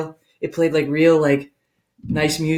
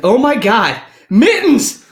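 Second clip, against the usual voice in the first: loud, high mock horror; acted-out shock, rising to a shriek; horrified cry; 6 semitones higher, 7 dB louder, slower than usual. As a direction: cry out for a dead pet. Horrified owners find dead cat.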